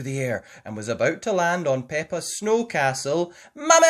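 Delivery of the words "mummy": high, very loud alarmed cry; girl seeing ball coming; shouting. Acted. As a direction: yell for help. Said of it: "shouted Peppa".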